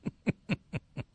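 quiet, breathless chuckle; soft laughter. Real, not acted. Host tickled by the Carlin quote, laughing quietly. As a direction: laugh softly, almost without voice.

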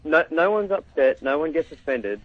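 ordinary talk

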